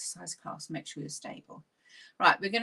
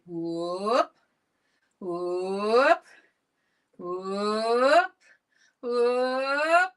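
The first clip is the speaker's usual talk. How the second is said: building whoop calls; 6 dB louder, much slower than usual. Shown as a demonstration. Instructor leading class in playful whooping calls. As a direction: whoop it up, building each time. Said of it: "And we're going to say".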